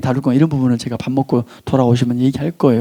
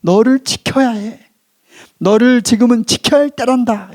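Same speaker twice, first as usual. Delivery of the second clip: higher, insistent protective appeal; 분노의 메시지; 7 semitones higher than usual. Acted. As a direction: speak as a protective inner voice, pressing each phrase. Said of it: "분노는". (높은 음으로 힘주어 타이르듯)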